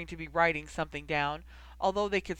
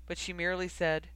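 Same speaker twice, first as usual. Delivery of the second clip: ordinary delivery, nothing marked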